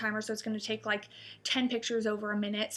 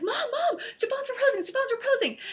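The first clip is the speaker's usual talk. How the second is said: much higher, laughing, calling out; excited sister; 14 semitones higher, 4 dB louder than usual. Retold or imitated. Sister calling mom from the window, high laughing excitement. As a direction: squeal it through a laugh. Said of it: "she's like".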